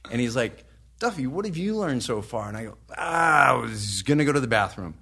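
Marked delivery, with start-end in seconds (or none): 1.0-2.5 higher, cheery presenter calling on someone; lightly voiced presenter putting him on the spot; seminar speaker calling out. 3.0-5.0 higher and louder on the ah, blurting; caught-out excuse, blurted; flustered retold excuse.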